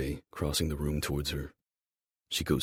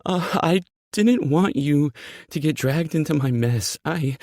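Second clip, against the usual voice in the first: high, loud, tearful confession; distraught high-pitched voice; near sobbing; 11 semitones higher, 8 dB louder than usual. Acted. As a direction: blurt it out, close to sobs. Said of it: "breath that comes out like a sob".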